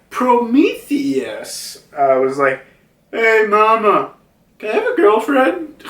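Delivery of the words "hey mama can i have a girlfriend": high, put-on cartoon pleading; childlike request. Prometheus asks Mama for a girlfriend, childlike request. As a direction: ask Mama in a high little voice. Voices Prometheus asking his mother.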